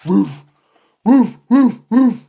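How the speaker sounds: loud woof woof bark; dog barking. Acted. The scary dog barking at Piggy: loud barks.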